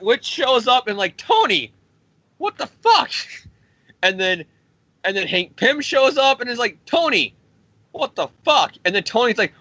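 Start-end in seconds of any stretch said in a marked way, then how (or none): 1.2-3.1 high-pitched mock dismay; appalled outburst. Scarlet Witch scolds drunken Tony, high-pitched mock outrage. 6.9-8.7 high, exasperated mock cry; appalled outburst. Hank Pym confronts drunken Tony, high-pitched mock outrage.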